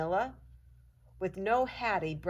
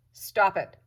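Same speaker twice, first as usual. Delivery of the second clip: loud, stern command; sharp scolding stop; 4 semitones higher, 10 dB louder than usual. A narrator's reading. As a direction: snap it at the dog. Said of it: "she says in a sharp voice".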